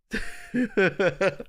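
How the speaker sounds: high laugh. Real, not acted. Laugh after teasing.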